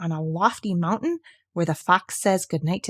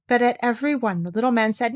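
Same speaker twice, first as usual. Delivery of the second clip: plain reading voice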